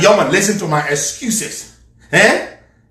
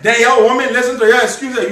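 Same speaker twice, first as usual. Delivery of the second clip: ordinary delivery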